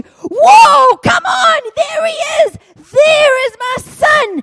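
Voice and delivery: thrilled father's shout; joyful shout. Retold or imitated. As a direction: shout it out as you see him coming. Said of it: "he just starts to".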